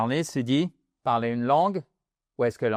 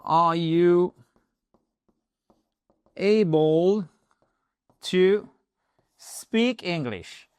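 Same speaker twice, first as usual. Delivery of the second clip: slow, spaced-out model question; 3 semitones higher, much slower than usual. Shown as a demonstration. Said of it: "vous pourriez aussi me suggérer".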